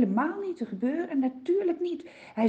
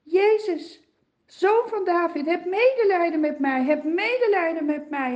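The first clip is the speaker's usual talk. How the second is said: high, loud pleading cry; calling louder; 7 semitones higher, 5 dB louder than usual. Acted. Blind beggar keeps calling to Jesus, high and loud. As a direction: beg at the top of the voice. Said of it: "steeds harder roepen".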